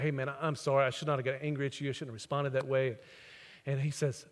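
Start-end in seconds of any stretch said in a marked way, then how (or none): 0.0-3.0 sincere apology. Retold or imitated.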